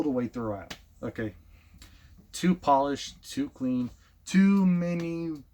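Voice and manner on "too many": a hint of mock overacting; overacting demo. Shown as a demonstration. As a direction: ham up every word like a bad animated show. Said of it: "I know I'm doing this".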